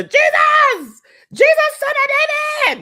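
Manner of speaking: high, loud cry for Jesus; yelling out. Retold or imitated. Blind man crying out to Jesus, loud and high. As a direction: call out desperately, high and loud. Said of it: "he was yelling out to Jesus".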